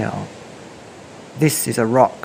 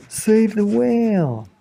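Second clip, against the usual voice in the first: higher, slow plea; trail message; 10 semitones higher, slower than usual. Acted. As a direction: read the trail's plea, slowly. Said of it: "a silvery trail saying".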